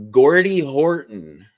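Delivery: put-on slow, drawn-out Canadian accent on Gordy Horton; a hammed-up Canadian accent. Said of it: "that's an awful rendition of a Canadian accent".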